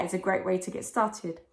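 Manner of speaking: ordinary talk, nothing marked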